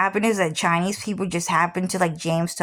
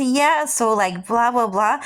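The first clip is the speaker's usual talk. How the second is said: higher, dismissive blah-blah; mock chatter; 4 semitones higher than usual. Mocking how she sounded talking to classmates. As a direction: brush off her own words as babble. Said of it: "I would just say".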